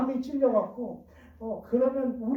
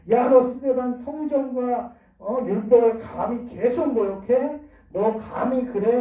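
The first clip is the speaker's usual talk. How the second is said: higher, louder, drawn-out indignant accusation; acted outrage; 4 semitones higher, 5 dB louder, slower than usual. Enraged council accusing Stephen of insulting the law, indignant. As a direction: voice the enraged crowd, slow and loud. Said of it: "극한 격분과 분노의 감정을 느낄 때".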